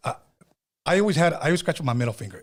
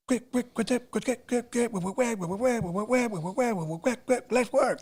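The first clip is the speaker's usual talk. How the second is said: high, soft vocal record scratches; imitated DJ scratching; 10 semitones higher, 3 dB quieter than usual. DJ shows how a deejay scratched a cappella, imitated scratching. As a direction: mimic scratching with rapid squeaky syllables. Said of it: "And then he started".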